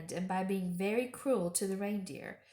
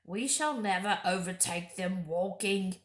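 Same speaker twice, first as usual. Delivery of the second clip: growling dwarf; 2 semitones lower than usual. A narrator's reading. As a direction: grumble the objection. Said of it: "growled the dwarf".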